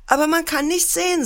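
put-on high, quick child's objection; storyteller's high-pitched little boy; protesting child. A narrator's reading. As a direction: pipe up quickly like a worried boy. Scared boy objects to father.

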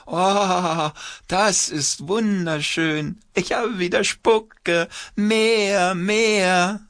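very high, slow delighted puppet. A narrator's reading. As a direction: squeal with joy as a wet puppet. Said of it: "Die schreit auf".

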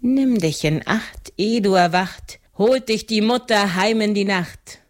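plain reading voice

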